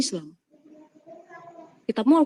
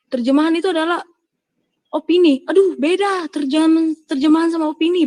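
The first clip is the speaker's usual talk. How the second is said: louder and higher, hammering the point; heated raised voice in a debate; raised emphatic voice; 6 semitones higher, 5 dB louder than usual. Real, not acted. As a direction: push it up, exasperated. Heated argument about translation.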